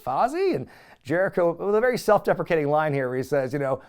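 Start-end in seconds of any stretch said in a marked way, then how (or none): 0.0-0.6 high, rising puzzled question; baffled echo of an unknown name; puzzled query. Retold or imitated. Band baffled by the name of Jericho's group, puzzled.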